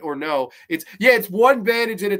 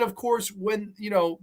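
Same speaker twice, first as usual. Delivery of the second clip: ordinary talk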